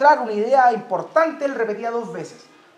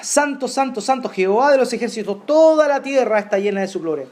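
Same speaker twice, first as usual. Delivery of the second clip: preaching proclamation; 5 dB louder than usual. Acted. Proclaims scripture.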